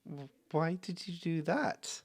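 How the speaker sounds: a lightly voiced wounded boy; hurt child. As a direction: ask it like a kid who just got shoved. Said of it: "He goes".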